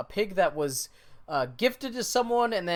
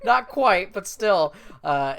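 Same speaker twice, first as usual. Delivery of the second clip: normal talking voice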